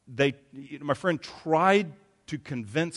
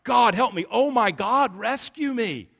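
high, loud desperate cry; 9 semitones higher, 6 dB louder than usual. Retold or imitated. A person in danger crying out to God, desperate and loud. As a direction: plead loudly for rescue. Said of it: "if you're crying out".

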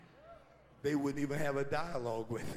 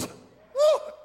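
high, loud whoop of joy; joyful preacher whoop; 13 dB louder than usual. Real, not acted. Pastor whooping to stir the congregation, high joyful whoop. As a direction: let out a celebrating whoop.

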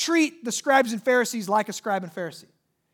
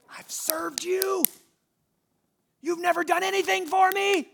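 mocking whisper; 10 semitones higher than usual. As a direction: sneer it in a breathy child voice. Preacher mocks brother in whisper.

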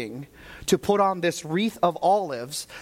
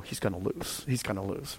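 much softer and lower, fretting; worried murmur; 8 semitones lower, 9 dB quieter than usual. Retold or imitated. Father watching his son losing, soft worried murmur. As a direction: mutter it under your breath in worry. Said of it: "I go".

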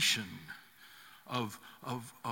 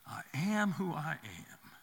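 softer, slow and reverent echo; God's answer, hushed; 7 dB quieter, slower than usual. Acted. God's name repeated to Moses, softer and reverent. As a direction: let it fall away to a hush.

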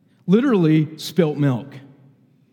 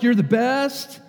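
higher-pitched mock praise; imitating bipolar boss; 7 semitones higher, slower than usual. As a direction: mimic a boss lavishing praise. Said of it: "she really did say it like this".

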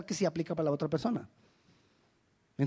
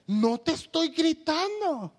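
slow, high, raised-voice protest; mock yelled denial; 8 semitones higher, 3 dB louder, slower than usual. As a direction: deny shouting while nearly shouting. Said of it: "Me estás gritando".

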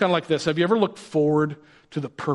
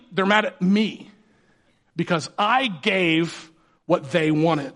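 usual speaking voice

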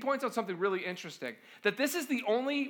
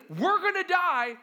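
panicked cry; 8 semitones higher, 4 dB louder, slower than usual. Acted. Disciples panicking.

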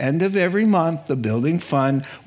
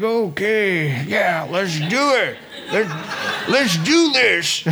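put-on high, keen old man agreeing; hammed-up old man saying yes; eager old priest; 6 semitones higher, slower than usual. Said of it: "And the guy's like".

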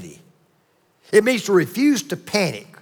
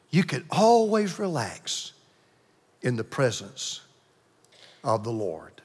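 fading to a hush; 9 semitones lower, 9 dB quieter than usual. Acted. Sermon line fading to hush.

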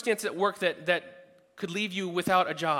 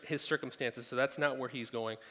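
ordinary talk, nothing marked

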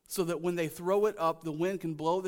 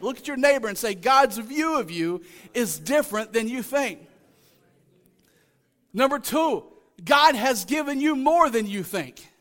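raised, emphatic preacher's delivery; full-force preaching; 5 semitones higher, 6 dB louder than usual. Real, not acted. Preacher tells congregation God has given more, preaching forcefully. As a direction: drive it home to the congregation.

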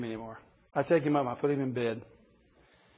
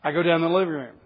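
normal talking voice

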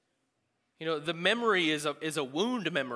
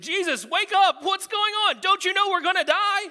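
mock panicked shout, much higher and louder; panicked yell; 13 dB louder than usual. Disciples yelling at sleeping Jesus, panicked shout. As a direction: scream it like the boat is sinking. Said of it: "They're like".

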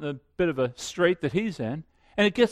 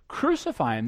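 ordinary talk, nothing marked

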